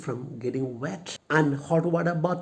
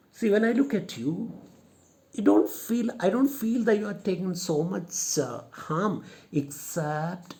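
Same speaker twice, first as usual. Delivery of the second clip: 1 hesitant lady; 6 semitones higher than usual. Shown as a demonstration.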